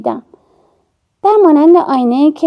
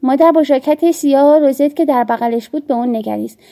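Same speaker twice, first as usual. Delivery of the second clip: ordinary delivery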